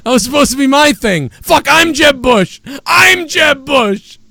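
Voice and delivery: high, shouted fake panic as the candidate; mock panicked yell. Host voicing the candidate's panic after losing the spotlight, hammed-up shouting. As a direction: ham up a candidate's inner meltdown, yelling. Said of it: "he realizes".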